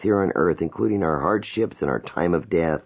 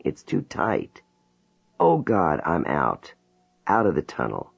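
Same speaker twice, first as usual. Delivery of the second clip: a woman overwhelmed and begging to go back; terrified pleading; 3 semitones higher than usual. Acted. Jane in regression, begging the hypnotist to let her go back. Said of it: "She begins sobbing".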